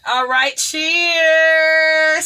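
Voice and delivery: loud cheers, hugely drawn out; celebratory toast. Real, not acted. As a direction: call out the toast, holding the word long. Raises glass for a toast.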